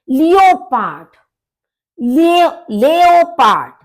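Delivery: लेओपार्ड said the German way, slow and loud; teacher models लेओपार्ड for Hindi learners; German pronunciation. Shown as a demonstration. Teacher models the German word slowly. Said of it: "German में आपका लेओपार्ड है".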